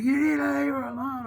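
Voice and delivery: high, loud mock screech; freaking out. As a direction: imitate a landlord yelling down the phone in a panic. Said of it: "freaking the fuck out".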